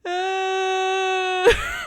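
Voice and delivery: high loud shriek of fright; sudden wail; child's scared cry. Retold or imitated. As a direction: scream like a startled toddler.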